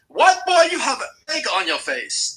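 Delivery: high, loud mock UK accent; bad UK accent. Mimicking a woman's taunt to mock her, in a bad UK accent. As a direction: put on a shrieky fake British voice. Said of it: "I can't do, like, a, a U K accent".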